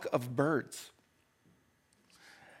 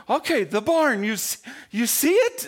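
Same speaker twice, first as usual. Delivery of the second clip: higher and louder, pleading with the flock; coaxing call to birds; 7 semitones higher, 5 dB louder than usual. Retold or imitated. Man trying to herd panicked birds into the barn, coaxing call. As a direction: urge the birds toward the barn.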